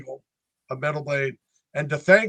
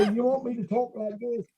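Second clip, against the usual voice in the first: mock British accent, pitched up; higher-pitched stock British caricature; 6 semitones higher than usual. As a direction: put on a cartoonish British accent for a joke. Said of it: "where's your British accent?".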